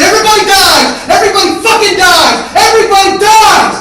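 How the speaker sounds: very high, full-force repeated scream; yelling. Retold or imitated. Man driving at a brick wall, yelling. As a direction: chant the threat at the top of your lungs. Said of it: "me yelling".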